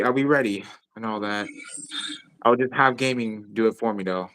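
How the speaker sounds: ordinary talk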